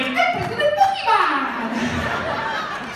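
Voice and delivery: slow, very high put-on voice; drawn-out cartoon candidate; President Pokemon voice. Pretends to be a candidate.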